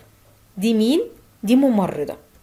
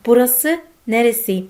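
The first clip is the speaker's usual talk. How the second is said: slow demo; 3 semitones higher, 5 dB louder, much slower than usual. Shown as a demonstration.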